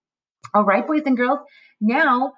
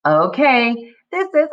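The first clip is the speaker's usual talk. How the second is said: usual speaking voice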